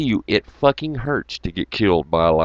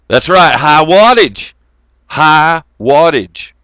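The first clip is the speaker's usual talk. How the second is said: slow, loud, each word stretched; drawn-out emphasis; 4 semitones higher, 8 dB louder, slower than usual. Real, not acted. Echoing a chat comment, slow and emphatic. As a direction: savor the phrase high wattage. Repeating chat phrase slowly, loudly.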